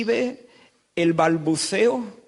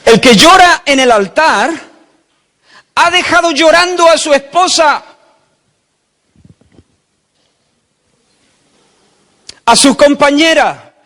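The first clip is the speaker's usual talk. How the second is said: much louder, higher, full-force denunciation; preaching shout; 8 semitones higher, 12 dB louder than usual. Real, not acted. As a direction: thunder it from the pulpit. Preacher denouncing the weeping worshipper. (grito de predicador, mucho más fuerte y agudo)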